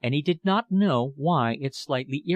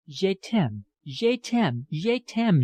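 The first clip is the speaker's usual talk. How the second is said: put-on extravagant French accent; 6 semitones higher, much slower than usual. A narrator's reading. Miss Wilkinson declares love to Philip, French accent swelling. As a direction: gush je t'aime in a hammy French accent, building up. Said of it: "with her extravagantly French accent".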